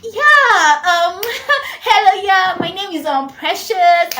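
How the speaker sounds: put-on high, affected foreign-style accent; put-on posh accent. Girl introducing herself to her crush, affected accent. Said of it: "do the whole accent thingy".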